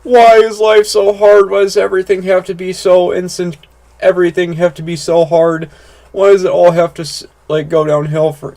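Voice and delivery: mock crying whine. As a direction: whine it as if about to cry. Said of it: "crying for no reason".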